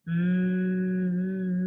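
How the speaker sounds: long steady humming buzz; bee voice. Shown as a demonstration. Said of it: "sound like a bumblebee".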